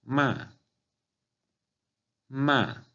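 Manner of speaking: "ma" starting high and falling sharply; fourth tone. Shown as a demonstration. Teacher demonstrating fourth Chinese tone, "ma" high then falling. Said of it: "Cuarto tono es un tono ascendente".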